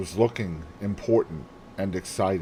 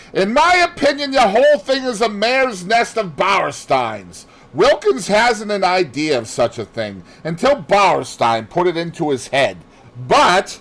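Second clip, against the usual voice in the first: loud, higher heated rant; vehement anger; 10 semitones higher, 7 dB louder than usual. Acted. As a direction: tear into the idea without pause. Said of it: "cried Lawrence angrily".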